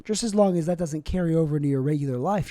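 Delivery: ordinary delivery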